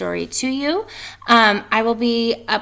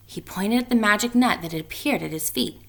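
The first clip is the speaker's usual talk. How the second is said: ordinary delivery, nothing marked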